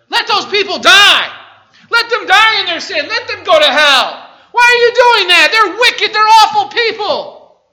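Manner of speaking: screamed high, very loud; outraged Israelites. Retold or imitated. Israelites furious at Jonah for going to Nineveh, screaming. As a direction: rail at him in disbelief and fury.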